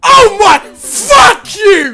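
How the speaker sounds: loud, high, drawn-out curse; exasperated shout. Real, not acted. Gamer trolled by the game, exasperated shout. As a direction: stretch the curse into a yell.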